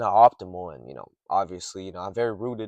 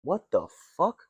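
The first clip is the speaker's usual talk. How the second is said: startled; 7 semitones higher, slower than usual. Real, not acted. Hears strange noise from car.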